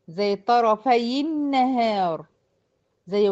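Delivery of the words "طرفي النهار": very slow "طرفي النهار" as tarafayi-nnahar; drawn-out tajweed example, "طرفي النهار" linked with i; demonstrating the kasra link. Shown as a demonstration. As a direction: hold the doubled n in "طرفي النهار" after a short i. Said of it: "تحريك الساكن الأول بالكسر".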